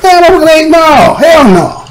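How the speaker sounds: slow, high, drawn-out hell no; incredulous hell no; emphatic no. Real, not acted. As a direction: stretch out the refusal in disbelief. Emphatic denial in rant.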